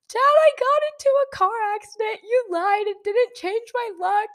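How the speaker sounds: very high, loud mock bawling; hysterical wail. Daughter phoning dad after car crash, hysterical wail. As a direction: re-enact a panicked, wailing phone call. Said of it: "I'm hysterical still".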